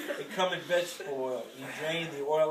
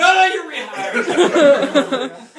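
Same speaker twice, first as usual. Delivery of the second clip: very slow, much louder, high, laughing plea; David panicking; 10 semitones higher, 16 dB louder, much slower than usual. Retold or imitated. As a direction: drag it out loud and high in mock panic. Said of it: "And David would go".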